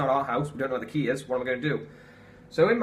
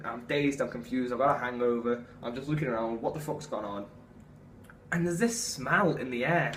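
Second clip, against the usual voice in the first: ordinary talk, nothing marked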